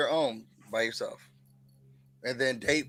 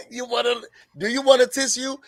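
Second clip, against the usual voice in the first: mocking; 9 semitones higher, 4 dB louder than usual. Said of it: "she even tried to give him a little dab".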